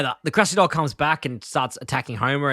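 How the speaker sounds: ordinary talk, nothing marked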